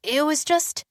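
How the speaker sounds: high, timid start of an excuse; nervous young assistant; cringing. A narrator's reading. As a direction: begin explaining meekly. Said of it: "she cringed".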